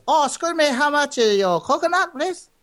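high-pitched mock Chinese accent; hammed-up Chinese accent, politely asking. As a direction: put on a high, loud Chinese customer accent. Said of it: "that was the Chinese guy".

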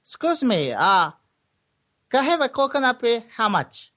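high mock Chinese customer accent; hammed-up, high Chinese accent. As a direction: ask eagerly in a put-on Chinese accent. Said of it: "that was the Chinese guy".

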